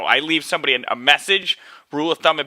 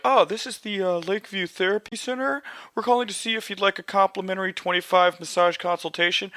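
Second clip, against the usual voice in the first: high, soft, put-on receptionist voice; telemarketer voice; 5 semitones higher, 4 dB quieter than usual. Therapy center caller offering a consultation, telemarketer voice. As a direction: pitch it up into a polite cold-call voice.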